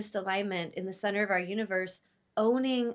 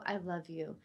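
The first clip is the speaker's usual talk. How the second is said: soft tender; 4 semitones lower, 8 dB quieter than usual. Shown as a demonstration. Deep loving words after the practice.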